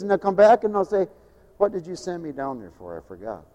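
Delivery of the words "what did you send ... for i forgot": much lower, far softer daze; foggy sleepwalker voice; dazed mumble. Retold or imitated. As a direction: mumble it half-asleep.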